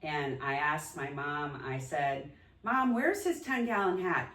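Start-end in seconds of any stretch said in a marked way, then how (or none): 2.6-4.3 higher-pitched quote of a daughter asking; retold questioning. Daughter asking mom about dad's hat, retold questioning. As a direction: ask it high, as a re-enacted query.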